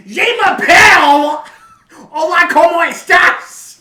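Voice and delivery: high, loud, slow squawking shout; grating comic yell, drawn out; Gilbert Gottfried impression. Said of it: "My Gilbert Godfrey impression".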